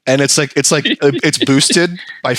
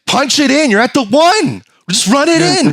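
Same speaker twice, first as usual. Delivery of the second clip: excited yelling at TV; 13 semitones higher than usual. Retold or imitated. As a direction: yell the team on from the couch. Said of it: "he's like".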